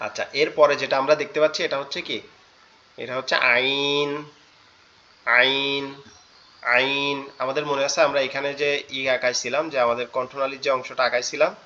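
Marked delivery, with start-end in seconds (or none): 3.0-7.2 আইন said from the middle of the throat, squeezed; slow, throaty আইন; Arabic letter ain. Shown as a demonstration. Teacher names the letter আইন slowly. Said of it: "একটু চাপা আওয়াজে".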